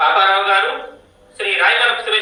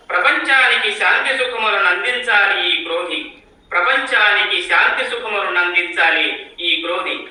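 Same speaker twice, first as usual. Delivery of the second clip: higher, measured poetic declamation; poem recitation; 4 semitones higher than usual. Acted. Poet reciting a new-year Ugadi poem to the gathering, poetic recitation. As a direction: recite it line by line with a lilting rise and fall.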